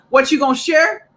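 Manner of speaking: mock teacher's challenge, pitched high; high, put-on questioning voice. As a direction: imitate a teacher catching a pupil. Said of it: "what the teachers always used to say".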